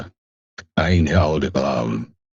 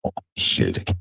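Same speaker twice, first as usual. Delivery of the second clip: normal talking voice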